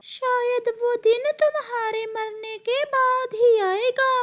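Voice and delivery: very high, needling cartoon voice; high-pitched mocking bird; taunting bird character.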